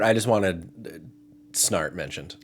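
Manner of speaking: ordinary delivery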